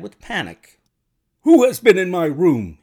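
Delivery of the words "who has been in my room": loud, higher-pitched alarmed demand; panicked cry. A narrator's reading. Lodger bursts in on the doctor, crying out. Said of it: "he cried".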